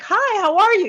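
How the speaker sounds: high, loud, free and easy hello; an uninhibited cheerful hi; casual bright greeting. Shown as a demonstration. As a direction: greet like an old friend, no restraint. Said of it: "You have to go".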